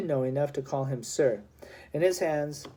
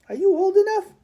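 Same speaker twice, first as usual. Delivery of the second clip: much higher, loud disbelieving question; doubting sergeant; 8 dB louder, faster than usual. A narrator's reading. Sergeant questions the small boy's age, high and loud. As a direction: doubt he's old enough.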